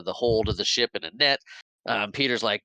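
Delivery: ordinary talk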